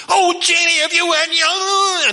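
high, shouting, then dragged slow; panicked boy. Acted. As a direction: wail out the blame at her. Brother blames sister in panic.

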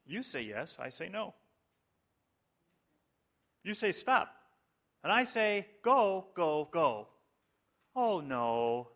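deadpan dramatic reading of pop lyrics; mock-solemn lyric recital. Preacher reciting Beatles lyrics to the congregation, mock-solemn. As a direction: recite silly lyrics like weighty poetry, with big pauses. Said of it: "start with a quote from some of their lyrics".

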